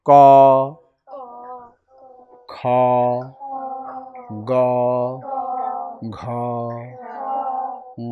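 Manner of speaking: one letter per slow exhale, spaced out; slow breath-held letters. Shown as a demonstration. Teacher demonstrating breath control, letters spaced slowly on one breath. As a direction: let the breath out a little with each letter. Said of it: "নিয়ে কি করছি".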